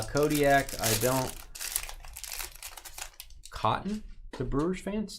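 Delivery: normal talking voice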